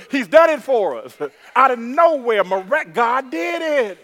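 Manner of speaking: loud, high preaching; exultant testimony. Real, not acted. Preacher testifying God did it, exultant. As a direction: shout the testimony to the congregation.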